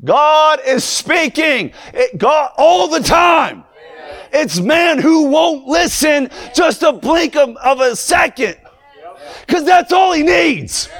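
preaching at full force. Acted. Preacher urging the congregation that God speaks, shouting slowly. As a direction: build the fire and hammer each phrase.

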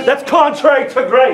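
high-pitched, fervent pulpit delivery; full-force preaching. Real, not acted. Preacher urging church to drop its religion, impassioned. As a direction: drive it home to the congregation.

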